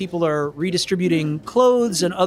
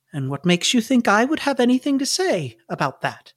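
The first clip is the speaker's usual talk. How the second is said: put-on higher, swooping old-lady question; guarded old nun; 5 semitones higher than usual. Sister Mary deflects Sam's question about bricks, guarded. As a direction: turn the question back, pitched up. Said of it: "widens her eyes a little and she says".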